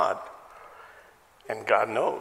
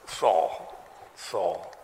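lower, solemn calling of the name; a hint of a deep divine voice; voice of God; 6 semitones lower than usual. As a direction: call the name slowly as God would. Preacher voices God calling twice.

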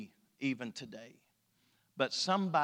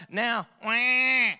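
mock baby wail; 6 semitones higher, 6 dB louder than usual. Imitating a crying baby.

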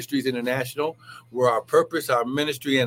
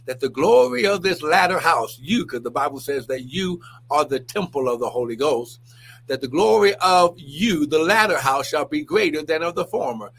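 preaching with force; 2 semitones higher, 3 dB louder than usual. Acted. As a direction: preach it out strong.

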